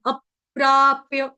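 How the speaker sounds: अप्राप्य said slowly, a half प before प्रा; slow and firm, अ-प्राप्य with a clear joined प्र; careful pronunciation. Shown as a demonstration. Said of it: "ऐसे बोलेंगे हम".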